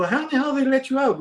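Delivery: high-pitched incredulous question; retold astonishment. Retold shock at friend's release.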